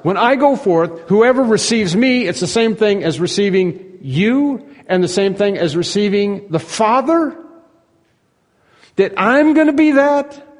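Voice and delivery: high-pitched disbelieving question, climbing at the end; incredulous disciple. Acted. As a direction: question it in astonished self-doubt, pitch rising. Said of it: "this is what the disciples".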